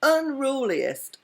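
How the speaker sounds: plain reading voice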